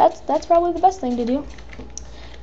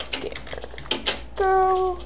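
ordinary talk, nothing marked

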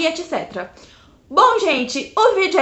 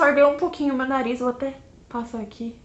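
muffled behind cupped hands; speaking through hands. Real, not acted. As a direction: hands over mouth briefly. Seen 1: Both hands cover nose and mouth until 1.6s, then drop.

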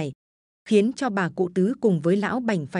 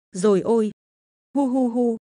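wailing; 3 semitones higher than usual. Acted. Old mother wailing at her son smashing the altar, sobbing. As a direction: cry out in panic for her son. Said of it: "hốt hoảng mà gào thét".

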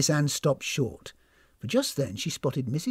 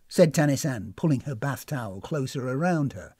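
ordinary delivery

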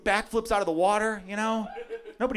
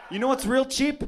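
mock rant; 5 semitones higher, slower than usual. Real, not acted. Ranting about Indiana.

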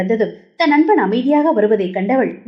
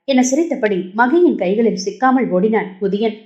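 plain reading voice

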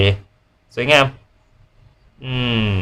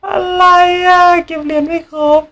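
sudden shrill-high shock, a little louder; shocked squeal; 6 dB louder than usual. Real, not acted. Streamer reacting to missing coins, shocked high yelp. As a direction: yelp up in disbelief.

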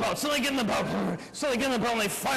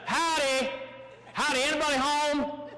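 calling out; 5 semitones higher than usual. Acted. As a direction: call up to see if anyone's in. Pirate calls up to the boat.

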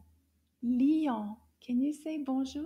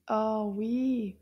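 usual speaking voice

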